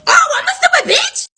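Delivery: high, incredulous laugh on it; can't believe she said that; outraged laughing repeat; laughing disbelief. Retold or imitated. Server reacts to insult.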